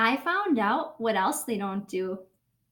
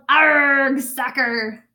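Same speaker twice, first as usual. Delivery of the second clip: loud pirate arg; hearty captain yell; 8 dB louder, much slower than usual. Acted. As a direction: bellow it after the kick. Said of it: "Braid Beard kicked the ball and yelled".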